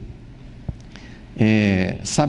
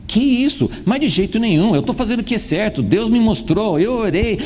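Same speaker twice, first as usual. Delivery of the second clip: indignant self-justification; 8 semitones higher than usual. Retold or imitated. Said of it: "nós vamos dizer".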